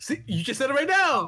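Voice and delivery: high, loud gotcha; triumphant call-out. Real, not acted. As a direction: burst out, catching him in the act. Catches friend saying the secret name.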